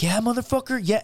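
taunting shout. Retold or imitated. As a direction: jeer at him like a drunk bully. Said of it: "And this guy's like".

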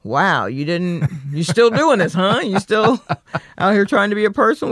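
incredulous. Retold or imitated. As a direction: act amazed they are still at it. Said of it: "Just an incredulous".

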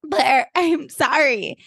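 high, slow mock-plaintive plea; acted apology. Jenny apologising to Blair, slow plaintive whine. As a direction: draw it out high and pleading.

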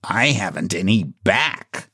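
understated glum clown voice; gloomy clown. A narrator's reading. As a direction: lament as a dejected clown. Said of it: "remarked the clown gloomily".

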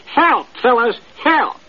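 high, urgent call to the flock; hollering for help. Acted. Little parakeet hollering to his friends, high call for help. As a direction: shout it up to the other birds. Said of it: "he hollered".

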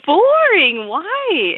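stunned disbelief; surprised. Retold or imitated. Amazed reply to old woman.

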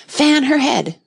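high, loud anxious interruption. A narrator's reading. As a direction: cut in, worried and urgent. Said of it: "the Red Queen anxiously interrupted".

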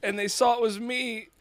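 ordinary talk, nothing marked

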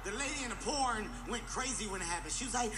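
ordinary talk, nothing marked

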